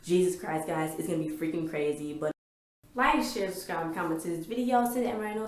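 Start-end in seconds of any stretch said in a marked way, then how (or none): 3.0-5.5 upbeat outro. Real, not acted. Channel outro plea.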